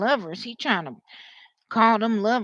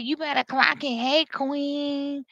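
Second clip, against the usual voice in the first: higher, drawn-out queen call; sassy queen talk; 4 semitones higher, slower than usual. Acted. Somebody talks like that, sassy queen imitation. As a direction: put on a sassy queen greeting. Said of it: "Somebody talk like that".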